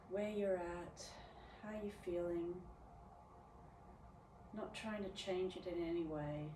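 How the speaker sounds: soft, unhurried meditation voice with long pauses; guided meditation. Real, not acted. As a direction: guide them gently inward, letting each phrase settle. Leads a meditative check-in.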